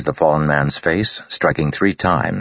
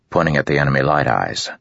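plain reading voice

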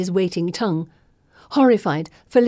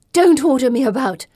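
loud, higher sharp snap; snapping; 6 semitones higher, 6 dB louder than usual. Acted. Pippa snapping at May for ordering her. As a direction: bite back at them. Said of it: "Pippa snaps".

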